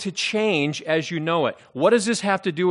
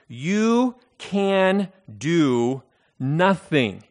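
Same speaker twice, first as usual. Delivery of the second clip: slow, emphatic re-reading of the verse; preacher re-reads verse five with weight; emphatic scripture; 2 semitones higher, 5 dB louder, much slower than usual. Acted. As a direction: press each phrase home, very slowly.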